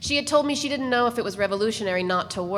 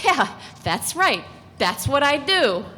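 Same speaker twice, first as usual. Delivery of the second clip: higher and slow, a wry knowing admission; a hint of Nadine, slow and higher; lightly voiced Nadine; 6 semitones higher, 3 dB louder, slower than usual. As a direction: draw it out higher, owning it. Nadine's remembered thought.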